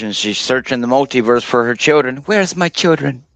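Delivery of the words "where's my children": lightly voiced grieving witch, higher; Scarlet Witch. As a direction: plead for lost children, dramatic. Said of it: "Starting to sound like the Scarlet Witch".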